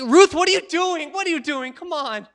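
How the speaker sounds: high, urgent pleading; exasperated Naomi. Acted. Acting Naomi pleading with clinging Ruth.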